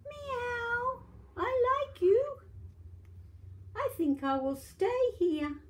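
very high, loud happy kitten; tiny kitten delighted. Acted. Grandma reading, kitten stays with boy, high kitten voice. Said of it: "The little kitten said".

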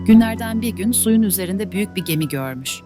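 plain reading voice

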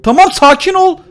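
loud, high exasperated yell; frustrated yelling. Real, not acted. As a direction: vent then try to calm yourself. Nefessiz kalıp sinirleniyor. (öfkeli bağırış)

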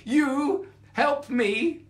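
loud, high, slow incredulous question; scornful disbelief. A narrator's reading. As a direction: draw it out in mocking amazement. Lioness scoffs at mouse's offer.

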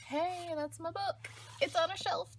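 light up on seeing your own book; thrilled little squeal of recognition; excited. Real, not acted. Spots her own book.

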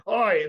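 high, loud yelp of pain; speared kobold crying out. A narrator's reading. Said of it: "the sniveling creature cries out".

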